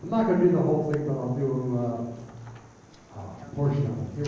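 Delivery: ordinary talk, nothing marked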